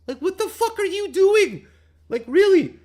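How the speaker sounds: yelling at hesitant buyers in disbelief; exasperated yell. Real, not acted. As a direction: blow up at them in disbelief.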